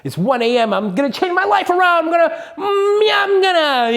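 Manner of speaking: hammed-up midnight enthusiasm; mock hyped. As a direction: parody a late-night burst of motivation. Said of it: "people be like".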